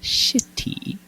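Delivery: "Shitty" whispered with a soft, crisp T; delicate T. Shown as a demonstration. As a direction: breathe "Shitty" and tap the T lightly. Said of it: "I'm trying to say the T, like, delicately".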